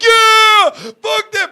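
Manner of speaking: high, drawn-out yell; crowd roar. Retold or imitated. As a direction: imitate a wild crowd cheering loudly. Imitating the crowd's reaction.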